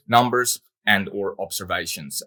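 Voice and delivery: ordinary delivery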